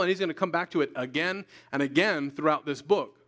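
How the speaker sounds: ordinary talk, nothing marked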